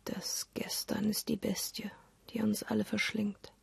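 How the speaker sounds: soft voiceless whisper, like a dark warning; ominous whisper. Acted. Narrator warning listeners about the past, ominous whisper. Ominous line in film essay.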